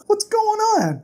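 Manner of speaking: very high-pitched alarmed question; hammed-up high worry. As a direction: squeal it in rising panic. Bast returns and finds trouble.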